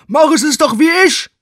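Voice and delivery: loud goofy come-on; cheesy workman. Acted. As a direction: bark it like a bad porn dub.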